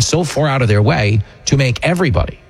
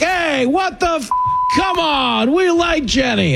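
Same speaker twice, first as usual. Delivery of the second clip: much higher, slow mock protest; indignant crowd member; slower than usual. Imitating the studio crowd defending his wife Jenny, higher mock protest. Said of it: "half the crowd feels an obligation to be like".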